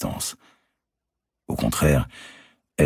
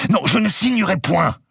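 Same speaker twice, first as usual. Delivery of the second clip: much higher, louder scream of refusal; shouting; 5 dB louder than usual. Acted. Marguerite refusing to sign, high furious scream. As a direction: scream it at him. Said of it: "criait-elle".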